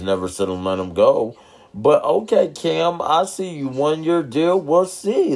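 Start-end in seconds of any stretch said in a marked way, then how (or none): 1.7-5.3 excited. Real, not acted. Cheering Cam Newton's signing.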